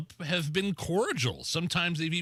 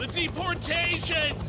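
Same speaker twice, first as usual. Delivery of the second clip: slow, very high strained call; hammed-up accented press shout; screaming reporter; 13 semitones higher than usual. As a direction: repeat it drawn out over chopper noise. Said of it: "everybody's just screaming at each other".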